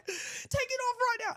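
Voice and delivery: high-pitched British barked order; British accent. Shown as a demonstration. Performer demonstrating the staff officer, British accent shout. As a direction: show off your best mock-British shout. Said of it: "that's my British accent".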